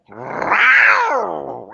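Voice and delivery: high, drawn-out cat growl; big cat yowl. Retold or imitated. Cat answering the soldier's shush, imitated yowl. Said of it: "the cat just goes".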